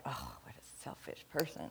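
hushed judgmental whisper. Retold or imitated. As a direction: whisper it like a private snap judgement. Mocking judgmental inner thought.

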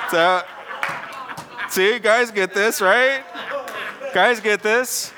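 high, loud, still laughing; preacher teasing the guys in the pews, laughing. Real, not acted. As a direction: ask the men through laughter. Still laughing after joke.

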